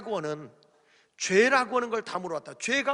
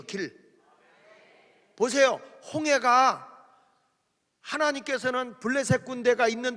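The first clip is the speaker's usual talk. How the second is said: raised, high-pitched pulpit exhortation; forceful preaching; 5 semitones higher, 6 dB louder than usual. Real, not acted. Preacher telling the congregation the Lord is the way, forceful preaching. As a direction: press each point on the congregation with force.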